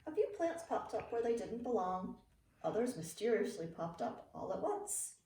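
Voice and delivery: ordinary delivery, nothing marked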